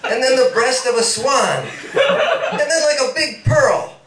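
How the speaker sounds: building from eager to breathless and high; rising rapture. Acted. Paul confessing to Peggy, building into breathless high rapture.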